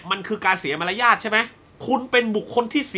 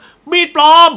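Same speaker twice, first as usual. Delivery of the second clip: very loud, high imitated yell; shouting out; 9 semitones higher, 11 dB louder, slower than usual. Imitating students shouting out a spoiler in the cinema, loud yell. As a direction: blurt it out like a cinema heckler. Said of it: "พวกแม่งตะโกนขึ้นมา".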